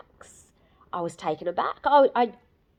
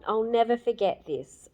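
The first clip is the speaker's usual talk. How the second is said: usual speaking voice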